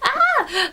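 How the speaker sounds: high laughing squeal of joy; delighted laughing "Ah!"; excited squeal. Retold or imitated. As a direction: squeal as if the book just ended. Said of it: "I was just like".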